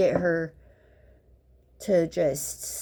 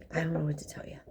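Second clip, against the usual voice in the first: breathy whisper, half to herself; whispered aside; 2 semitones lower, 7 dB quieter, much faster than usual. Acted. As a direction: drop to a whisper, resigned. Aside to patient about treatment.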